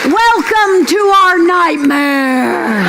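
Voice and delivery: slow booming shout. Acted. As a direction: proclaim it like a horror trailer. Dramatic comic proclamation.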